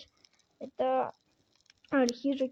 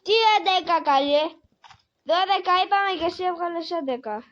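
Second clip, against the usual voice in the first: higher, louder indignant protest; indignant scold; 4 semitones higher, 6 dB louder than usual. Real, not acted. Gamer protests the wrong day count, high and indignant. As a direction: scold the mistake in high disbelief.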